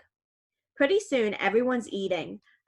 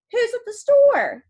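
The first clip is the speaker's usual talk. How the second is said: much higher, louder, bursting with delight; excited question; 13 semitones higher, 8 dB louder than usual. Acted. Girl asks her uncle, thrilled and loud. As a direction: ask it all lit up.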